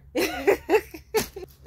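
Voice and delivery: loud high laugh; bursting laugh. Real, not acted. Laughing at her own creep story, loud laugh. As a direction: crack up.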